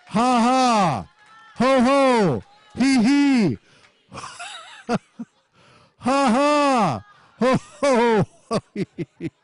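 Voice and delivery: high, emphatic laugh syllables; deliberate laughing. Shown as a demonstration. Preacher laughing at the enemy by faith, deliberate laughing. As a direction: belt out each laugh syllable on a high pitch. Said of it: "Laugh at the enemy".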